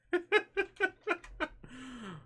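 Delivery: long, high-pitched giggle; laughing. Real, not acted. Player whose foe fell too fast, giggling. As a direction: let out a run of high chuckles.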